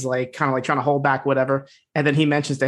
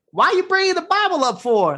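much higher, laughing through the quote; mock Ace voice; 13 semitones higher than usual. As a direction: squeal it out with a laugh, mocking Ace. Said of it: "Ace is like".

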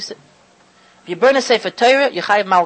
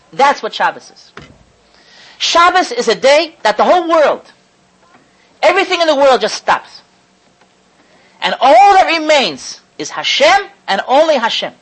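fervent preaching; 4 semitones higher, 5 dB louder than usual. Acted. Preaching at full force.

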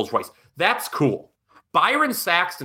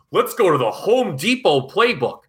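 higher, slower sponsor-plug announcer voice; mock Kirk Herbstreit; 6 semitones higher than usual. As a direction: imitate a sportscaster hammering out a sponsor plug. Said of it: "when Kirk Herbstreit goes".

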